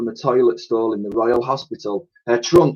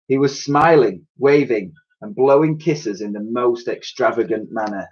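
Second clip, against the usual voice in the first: plain reading voice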